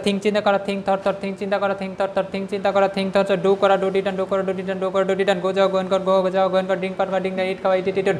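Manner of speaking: quick, even memorising chant, no pauses between words; rapid rote recitation. Shown as a demonstration. Teacher shows students how to memorise verbs, rapid rote chanting. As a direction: run the word lists together in a fast monotone. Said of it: "এরকম আপনি চালু করতে হবে".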